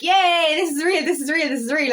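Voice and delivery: imitated excited celebration; mock elation. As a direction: gush it over and over like you can't believe it. Acting out elation at intense connection.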